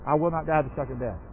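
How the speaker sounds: softly read. Shown as a demonstration. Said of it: "Pop that saying back up there".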